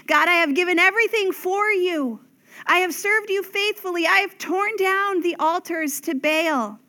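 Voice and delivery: Elijah's lament. Acted. Elijah pleads his case to God.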